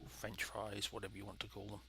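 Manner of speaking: ordinary delivery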